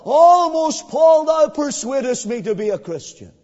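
loud declared quote of the king; preacher voicing King Agrippa loudly. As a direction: proclaim it as Agrippa would. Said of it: "testimony from the lips of King Agrippa".

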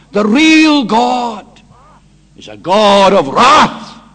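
loud, slow, building to a shout; preaching at full force. Acted. Preacher contrasts the serene Buddha with God's wrath, loud and building. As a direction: drive it up to a cry of wrath.

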